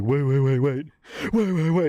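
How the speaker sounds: loud, high burst of frantic waits; imitated desperate movie-hero panic; panicked pleading. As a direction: plead in a panic, repeating it urgently. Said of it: "panicking as he watches his life end".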